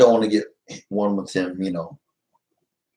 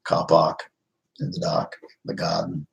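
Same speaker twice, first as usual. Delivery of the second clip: English accent demo; 5 semitones lower, 5 dB quieter than usual. Shown as a demonstration. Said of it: "I can say some things the English way".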